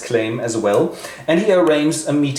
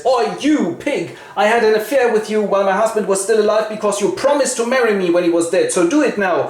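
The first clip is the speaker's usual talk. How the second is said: mock furious widow; 8 semitones higher, faster than usual. Hippolyta rushes at Zoranzo.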